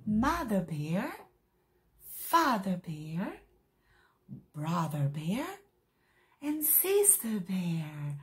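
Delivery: each name slow with a rising end; slow, clear English for little learners; slow teaching drill. Shown as a demonstration. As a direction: model the names slowly for kids. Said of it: "Ó".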